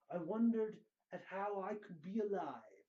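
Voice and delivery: ordinary delivery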